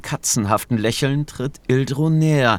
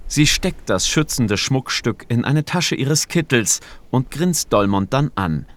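ordinary delivery, nothing marked

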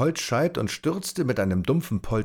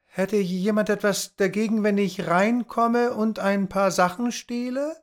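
Tom calling out; 13 semitones higher than usual. A narrator's reading. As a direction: call out higher, half joking. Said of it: "rief Tom". (hoch und frech rufend)

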